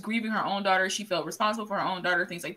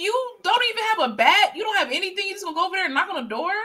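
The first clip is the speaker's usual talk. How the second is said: acted incredulity; 9 semitones higher than usual. Scolding the husband who has no bat, incredulous. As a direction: start way up high, then rush the last question.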